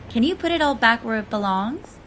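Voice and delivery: ordinary delivery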